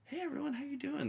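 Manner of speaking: bright fake-innocent hello home; innocent cheer. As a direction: act perfectly nice after raging. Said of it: "you're like".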